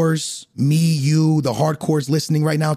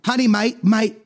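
bad English accent; 3 semitones higher than usual. Husband calls to his wife in bad English accent. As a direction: call out in a hammed-up English accent. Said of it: "accents are so bad".

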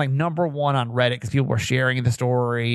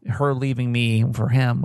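usual speaking voice

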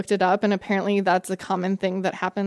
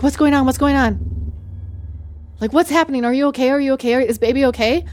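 imitating a panicked partner; 4 semitones higher, faster than usual. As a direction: retell his frantic rush of worry. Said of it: "And he's panicked".

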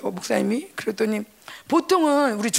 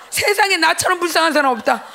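laughing mock lament; 6 semitones higher, 6 dB louder than usual. Mock self-pity while laughing.

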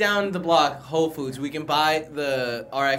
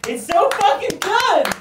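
high, drawn-out rave, much louder; gushing delight; 5 dB louder, slower than usual. Real, not acted. Friend raving about Speed Racer with delight. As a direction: gush about the movie.

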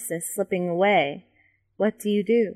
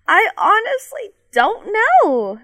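high, loud panicked plea; high-pitched frightened protest; crying out; 14 semitones higher, 6 dB louder than usual. A narrator's reading. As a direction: cry it out helplessly, voice pitched way up. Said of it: "you cry out".

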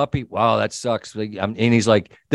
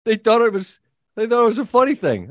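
imitating the wife; 10 semitones higher than usual. Teller imitating his wife's protest, higher voice. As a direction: play her protest, pitched up. Retold quote of wife, upset.

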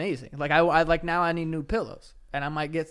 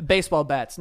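usual speaking voice

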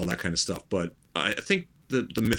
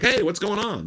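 high, breezy playboy greeting; put-on socialite cheer, much higher; public Bruce Wayne; 11 semitones higher than usual. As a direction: pitch it way up, casual and carefree. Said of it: "go and be Bruce Wayne".

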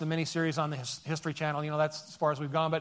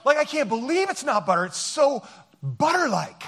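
higher, gushing slogan delivery with believe stressed; hammed-up ad read; 6 semitones higher than usual. Shown as a demonstration. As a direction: oversell the product like a commercial. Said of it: "No, it has to be like".

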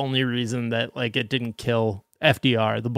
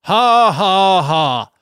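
mock laugh; 7 semitones higher, 9 dB louder, slower than usual. Mock laughter after outrage.

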